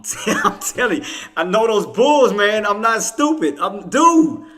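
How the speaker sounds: loud, high-pitched real protest; exasperated insistence. Real, not acted. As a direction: raise your voice, desperate to be believed. Insisting he is not crazy.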